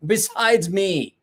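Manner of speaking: high, loud, incredulous shout at his wife; exasperated yell. Retold or imitated. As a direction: woken by the light, yelling at his startled wife. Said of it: "And I'm like".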